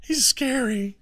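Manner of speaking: put-on very high whimpering kid voice; hammed-up tearful child's whine; scared kids. Dad imitating his kids scared of him, mock whimper. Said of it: "My kids are like".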